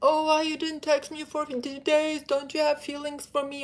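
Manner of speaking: high, fast, pleading mock girlfriend voice; whiny needy girlfriend. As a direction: act out a clingy girl begging for attention. Mocking a needy texting girlfriend.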